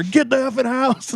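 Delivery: high, strained mock threat; mock angry boyfriend. Storyteller mimicking angry boyfriend threatening him, high and strained. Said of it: "And he's telling her".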